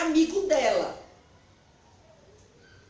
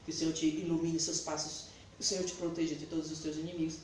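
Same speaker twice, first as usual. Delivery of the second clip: blessing; 7 semitones lower, 9 dB quieter, faster than usual. Retold or imitated. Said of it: "eu dizia assim".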